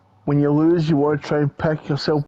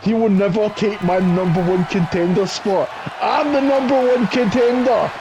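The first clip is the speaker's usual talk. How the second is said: raised pitch, climbing higher; defiant outburst; 6 semitones higher than usual. Real, not acted. Wrestler defending his contender spot, defiant and rising. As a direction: insist indignantly, building to a high declaration.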